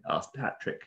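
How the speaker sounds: ordinary delivery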